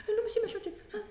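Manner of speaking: mock terrified child voice; scared boy. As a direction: squeak like a terrified kid stammering.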